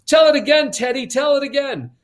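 high, loud, eager plea; clamouring kids. Retold or imitated. All the kids begging Teddy for the story, clamouring. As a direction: call out like excited children.

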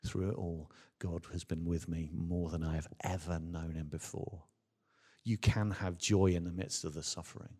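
ordinary talk

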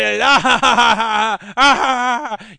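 loud, drawn-out cartoon villain cackle; long evil villain laugh. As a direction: cackle on and on like an eighties baddie. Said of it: "stand around laughing about nothing".

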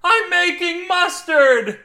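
very high, loud, slow scream; drawn-out scream. A narrator's reading. Husband proving himself to wife, drawn-out scream. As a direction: scream each word, stretched out.

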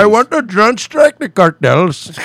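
high, loud, slow warmonger voice; mock politician. Host mocking politicians, slow proud hawk.

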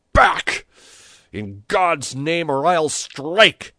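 high, loud, drawn-out warning; menacing shout. A narrator's reading. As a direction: drive back a mob with a shout. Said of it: "he shouted".

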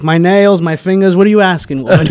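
a touch of loud sarcasm; sarcastic uncle. Retold or imitated. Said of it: "he was more sarcastic".

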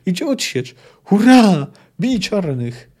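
rallying shout, bursting loud and high mid-way; battlefield yell. A narrator's reading. De Ruyter urges men against the Blacks, yelling. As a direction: shout a cheer to charge the enemy. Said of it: "wrzasnął de Ruyter".

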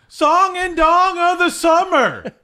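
high, loud infomercial voice; pitchman voice. Acted. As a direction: announce it like a TV ad. Announcing title loudly.

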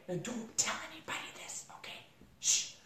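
hushed, conspiratorial aside; acted hush-hush confidence; secretive whisper. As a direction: whisper it like a secret, then shush. Instructor sharing a secret, retold.

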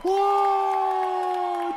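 high whooping cheer; excited shout. Acted. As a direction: cheer like you've won. Celebrating halfway to fifty.

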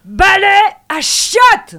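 high, loud angry shout. Pretend. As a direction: yell it in fury. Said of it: "Colère".

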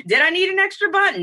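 usual speaking voice

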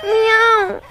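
high plaintive meow; a child's high kitten mew. Acted. Kitten Pitschi meows.

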